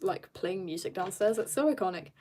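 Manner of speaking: ordinary talk, nothing marked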